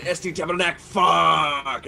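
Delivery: mock-angry outburst, punch the final curse; pissed off. Shown as a demonstration. Warning friends how Quebecers swear, truly pissed. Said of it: "you know they're pissed".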